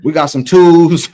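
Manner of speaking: loud, slow, dragged out in amused disbelief; mock disbelief. Real, not acted. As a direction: lean in hard on twos, slow and loud. Rereading a shocking low rating.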